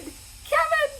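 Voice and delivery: high, loud joyful cry; excited shout. Retold or imitated. Boris recognizes Kevin at the meeting, a high joyful shout. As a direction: shout it like you've found a long-lost friend.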